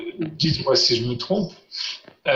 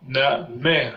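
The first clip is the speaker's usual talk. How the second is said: "namer" drawn out slowly, stressed on MER; stress on final syllable. Shown as a demonstration. Teacher demonstrating the final accent, slow stress on MER in "namer". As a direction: stretch the last syllable of "namer". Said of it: "avec l'accent".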